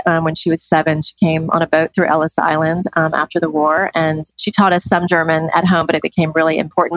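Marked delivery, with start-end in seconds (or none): none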